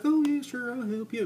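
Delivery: very high mock woman's voice, loud at the start; acted smitten woman. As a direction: pipe up eagerly in a put-on high voice. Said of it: "And she's like".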